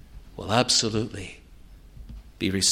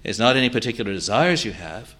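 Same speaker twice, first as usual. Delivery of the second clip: loud, high-pitched sermon delivery; forceful preaching; 8 semitones higher, 9 dB louder than usual. Real, not acted. Preacher pressing sinners to come to Christ, forceful. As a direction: preach it loud, driving home each phrase.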